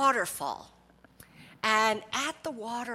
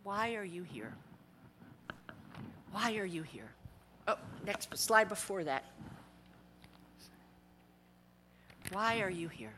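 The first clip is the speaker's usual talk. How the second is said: soft repeated question; 5 semitones lower, 14 dB quieter than usual. Acted. Preacher echoing Jane Goodall's question, soft and searching. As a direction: let the question hang.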